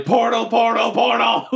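high-pitched mock yell from afar; distant yelling. Shouting to the party from the distance, yelling. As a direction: call it out high and urgent, repeating. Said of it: "I'm just yelling".

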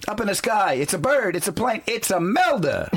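high dramatic announcer call. Pretend. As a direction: boom it out like a Superman intro. Superman announcer parody.

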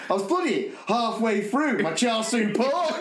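giggling mock outrage; laughing through the quote. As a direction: quote him while cracking up. Retelling his date's gross story.

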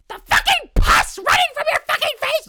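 high, loud shrieking mockery; hammed-up high screech of fake helpfulness; mocking yell. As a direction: shriek it like an obnoxious skincare fan.

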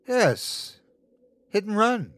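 high-pitched, gleeful confirmation; taunting creature. Acted. Creature confirms the husband's crime, gloating. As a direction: relish the reveal.

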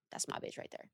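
whispered aside. Real, not acted. Fond aside about Lisa.

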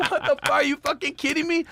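high, incredulous what the fuck; can't believe the guy is serious; high mock disbelief at a German [name]; retold disbelief. His own disbelief at the [name] guy.

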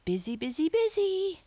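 high, climbing gleeful cry; happy shout. A narrator's reading. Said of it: "shouted happily".

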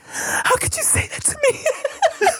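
high, slow, breathy mock sob; theatrical crying into the mirror; mock sad monologue. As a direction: ham up a wounded parent's lament. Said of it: "do a whole, like, fucking sad parent, like, a monologue".

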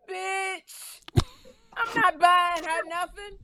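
high, drawn-out indignant impression; mock slow-motion refusal. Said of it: "it was in slow motion".

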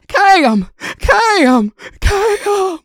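very high, loud, anxious calling of a name; imitating worried grandmother shrieking a name; Mimi calling. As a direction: call out to her over the phone, escalating.

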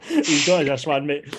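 a fond joke broken by laughter; laughing. Real, not acted. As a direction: laugh through the words. Laughing about late dad's gift.

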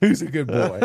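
put-on cooing, laughing baby talk. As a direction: coo it like to a puppy. Cooing at image of dog.

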